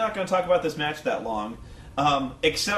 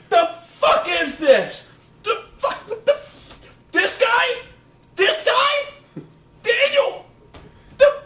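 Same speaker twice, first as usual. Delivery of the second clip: high-pitched mock sputtering disbelief; incredulous squawked questions; losing it; 7 dB louder, slower than usual. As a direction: imitate an appalled commentator, voice jumping way up. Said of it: "Cole lost his shit".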